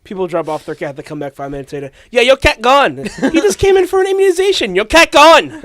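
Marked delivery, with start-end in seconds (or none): 2.1-5.6 mocking foreign clerk. Mocking a vet's broken English.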